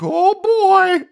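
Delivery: very high mock-mom voice; mom's reaction. Mom reacting to baby's spit-up, high-pitched mock exclamation. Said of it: "the mom's like".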